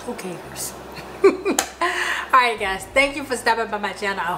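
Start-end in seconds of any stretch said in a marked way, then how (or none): 0.8-2.3 breathy laugh. Real, not acted. Laughing at crooked camera.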